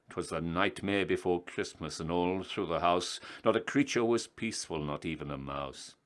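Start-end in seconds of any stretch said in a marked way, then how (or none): none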